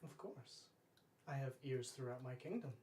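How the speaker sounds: ordinary delivery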